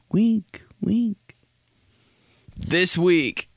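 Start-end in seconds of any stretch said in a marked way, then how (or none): 0.1-0.6 echo. Shown as a demonstration. 0.8-1.3 whispered echo. Shown as a demonstration. Host echoing the segment title in a whisper.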